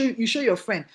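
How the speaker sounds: usual speaking voice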